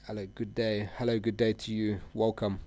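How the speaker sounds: ordinary talk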